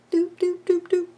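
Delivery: playful doop sounds. Retold or imitated. Mimicking scallop shapes with sounds.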